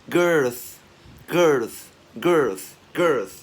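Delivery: "girth" punched out, hissing TH at the end; loud released TH. Shown as a demonstration. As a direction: drill "girth" loudly with the ending released. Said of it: "bật càng to thì càng hay".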